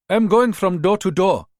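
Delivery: job-seeker with a Ghanaian lilt; Ghanaian accent. Acted. Rita posing as a maid to the mother, Ghanaian accent. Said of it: "staying with the Ghanaian accent".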